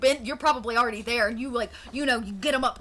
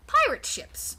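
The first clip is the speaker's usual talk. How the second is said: ordinary talk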